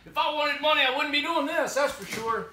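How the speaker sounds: loud, high-pitched refusal; worked-up, put-upon complaining; exasperated protest. Pretend. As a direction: protest it loudly, fed up at being woken. Still refusing money, being woken.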